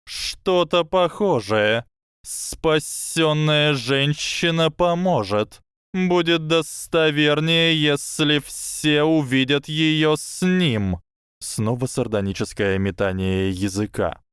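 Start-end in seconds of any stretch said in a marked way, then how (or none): 0.1-11.0 high, slow serpent voice; a hissing snake, slow and sly. Acted.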